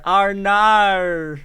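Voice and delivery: loud, high drawn-out Australian mock. Heckler mimicking her from the crowd, hammy Australian accent. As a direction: stretch it out in a hammy Aussie voice. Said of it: "just re".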